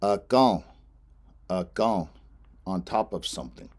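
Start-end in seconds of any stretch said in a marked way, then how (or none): 0.0-2.1 akan stressed on the a, nasal before the end; Lakota akan. Shown as a demonstration. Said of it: "stress is on the A".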